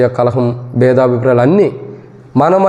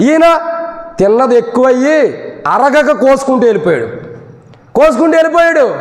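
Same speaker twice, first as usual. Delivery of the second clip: preaching at full force; 12 semitones higher than usual. Acted. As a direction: preach it out to the whole hall. (పూర్తి శక్తితో బోధించే స్వరం)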